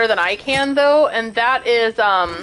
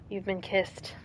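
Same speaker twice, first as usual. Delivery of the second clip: much softer, slow, tender; soft to the cat; 5 semitones lower, 15 dB quieter, slower than usual. Real, not acted. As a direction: say it softly after a kiss. Whispering to the cat.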